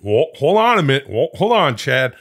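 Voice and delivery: rally through the names like a hype man; hyped-up bro. Real, not acted.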